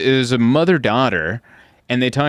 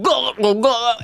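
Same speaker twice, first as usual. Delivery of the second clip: imitated loud gulping; 10 semitones higher than usual. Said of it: "a fist in her throat coming out".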